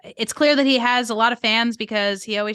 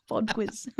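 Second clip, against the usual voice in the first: softer put-on British accent; 8 dB quieter than usual. Shown as a demonstration. Giggling over their only British phrase, soft British accent. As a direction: repeat it quietly in mock British. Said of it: "is that your best British accent".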